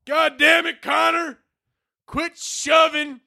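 high, slow Southern dad yell; attempted Southern accent. Dad yelling at Connor after school, mock Southern holler. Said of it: "I'm trying to do a southern accent".

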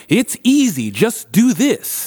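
mocking dismissive voice. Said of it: "being able to go".

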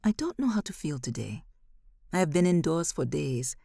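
ordinary delivery

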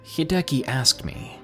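usual speaking voice

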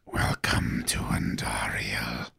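low unvoiced skeletal rasp; creepy whisper. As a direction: whisper like a crucified corpse. Skeleton signpost farewell.

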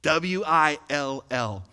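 spelling out W I L L letter by letter; each letter of W I L L said apart; spelled out. Shown as a demonstration. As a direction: slowly spell W I L L.

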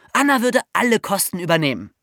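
ordinary delivery

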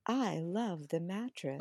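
slow hushed ad read; mock dreamy mattress ad; soft podcast voice. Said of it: "their voices were like this".

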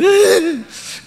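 high-pitched, loud comic wail; hammed-up bawling like a cartoon child; Kiko-style crying. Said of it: "como Kiko así llorando".

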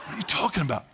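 ordinary delivery

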